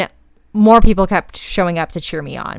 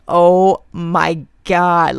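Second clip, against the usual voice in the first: overwhelmed; 4 dB louder, much slower than usual. Retold or imitated. Exhausted runner greeted by cheerful friends.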